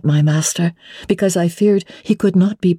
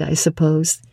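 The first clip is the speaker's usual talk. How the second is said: usual speaking voice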